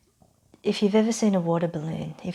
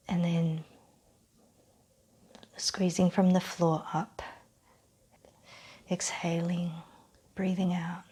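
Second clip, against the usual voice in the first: calm meditation voice, long silences between phrases; unhurried, settling relaxation voice; guided breathing exercise; 3 dB quieter than usual. Real, not acted. Leads breathing and ends exercise.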